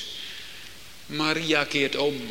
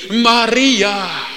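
een roepende Heer, luider; stem van de Heer; 6 semitones higher, 11 dB louder than usual. Retold or imitated. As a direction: roep haar naam als de opgestane. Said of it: "klinkt de stem van de levende". (de opgestane Heer roept luid Maria)

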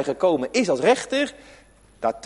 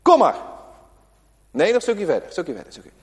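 calling out; faster than usual. Pretend. Calling the dog to come.

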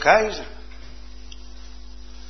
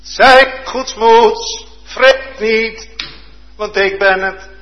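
louder, higher, very slow; fervent proclamation; 9 semitones higher, 9 dB louder, slower than usual. Acted. Preacher repeating Jesus' words to the disciples, loud and fervent. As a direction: proclaim it with rising fervour.

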